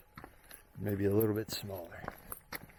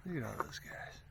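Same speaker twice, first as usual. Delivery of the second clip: whisper; 10 dB quieter than usual. Real, not acted. Hiker sees animals on trail.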